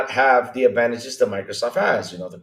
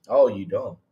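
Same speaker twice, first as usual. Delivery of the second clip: usual speaking voice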